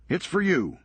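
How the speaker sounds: brisk, sardonic comeback; wry Serge. Acted. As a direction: toss it off dryly, quick. Serge hands phone.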